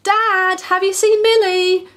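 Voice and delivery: put-on high, loud child call; shouting boy. A narrator's reading. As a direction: shout across the house for Dad. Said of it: "Billy shouted loudly".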